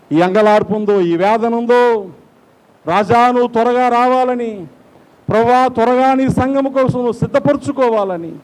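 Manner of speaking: urgent, high-pitched plea; fervent prayer. Real, not acted. Preacher begs the King to come soon, fervent prayer. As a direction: implore with a rising, ringing cry.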